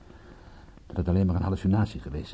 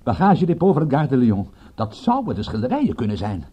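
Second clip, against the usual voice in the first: high, loud and triumphant; elated, raised-voice revelation; excited discovery; 3 semitones higher, 6 dB louder than usual. Acted. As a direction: announce the find with rising excitement. Finds the vital receipt.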